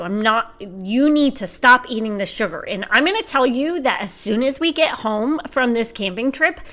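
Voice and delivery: hammed-up scolding, hitting the key words hard; son imitating mom. Teen son playing mom about sugar, mock scolding. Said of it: "this is him acting like me".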